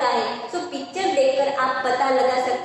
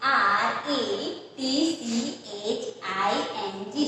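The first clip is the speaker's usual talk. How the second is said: slow spelling; 4 dB quieter, slower than usual. Shown as a demonstration. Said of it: "यानी कि".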